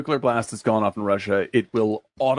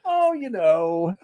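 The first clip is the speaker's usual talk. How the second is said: breezy martini chat; 7 semitones higher, much slower than usual. Retold or imitated. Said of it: "a suburban afternoon call for martinis".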